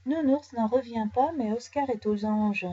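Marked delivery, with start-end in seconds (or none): none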